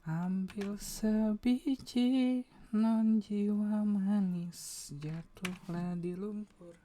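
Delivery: high slow sung woman's voice; man doing a woman's voice. Acted. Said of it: "aku pakai suara cewek".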